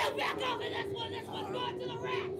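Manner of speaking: haunted-house guard shouting. Acted. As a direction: yell orders at the intruders. Scare actor barking orders.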